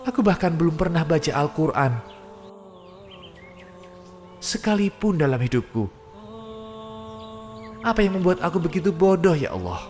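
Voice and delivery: high, pleading cry to God; anguished prayer of the dead; desperate begging. Acted. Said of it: "Kau memohon".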